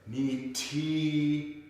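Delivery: NI TI drawn out, TI held level; with prosody. Shown as a demonstration. Teacher showing students what to focus on, exaggerated prosody. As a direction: stretch both syllables of Ni ti. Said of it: "really focus on the porosity".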